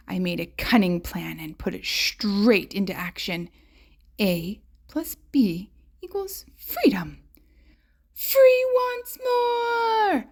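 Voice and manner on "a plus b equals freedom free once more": a whisper that rises very high and slow; whisper building. A narrator's reading. Beast escaping, whisper rising to a high free cry. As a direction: whisper the plan, then stretch out the freedom.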